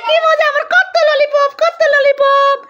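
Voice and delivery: put-on high, delighted kid voice; happy child. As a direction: gush like a thrilled toddler. Parent voices child thanking sister.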